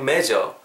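"measure" said like Korean 맺어; hard J in "measure", ending in plain "eo"; Koreanized MEASURE. Shown as a demonstration. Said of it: "이런 느낌이 아니고". ("measure"를 한국어 '맺어'로 발음)

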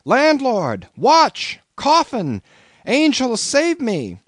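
high, loud panicked shout for help. A narrator's reading. Narrator shouting for the landlord in panic. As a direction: scream for the landlord in terror. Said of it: "shouted I".

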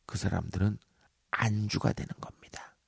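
unvoiced whispered punchline. Real, not acted. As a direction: whisper it like gossip. (속삭이는 농담)

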